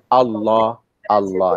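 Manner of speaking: Allah with a heavy full-mouth l. Shown as a demonstration. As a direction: darken the l in Allah. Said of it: "But here".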